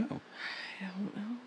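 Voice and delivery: breathy unvoiced whisper. Real, not acted. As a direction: whisper it slowly. Whispered answer.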